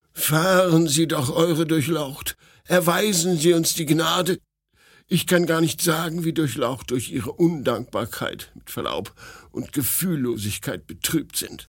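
lightly voiced imploring retainer; insistent start, easing into reproach; pleading servant. A narrator's reading. Mark begging the prince for mercy, earnestly pleading.

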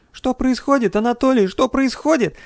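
high-pitched, alarmed voice; panicked questioning. A narrator's reading. Girl left uninformed asks the captain, high and alarmed. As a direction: plead for an answer in rising panic.

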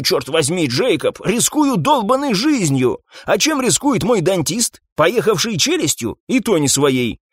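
high, agitated complaining; indignant rant. A narrator's reading. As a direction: vent your grievance at your partner, pitch climbing. Cop rants about unfair pay. (возмущённая тирада)

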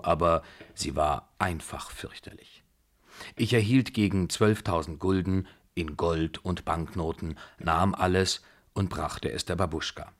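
ordinary delivery

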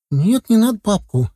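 put-on high, alarmed little-boy plea; frightened squeak. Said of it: "испуганно пискнул мальчик". (испуганно пискнуть)

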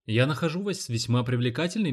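ordinary delivery, nothing marked

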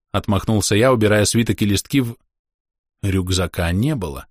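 ordinary delivery, nothing marked

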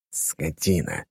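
low grumbling under the breath; muttered curse. A narrator's reading. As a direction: grumble it quietly, annoyed. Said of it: "пробубнил змей".

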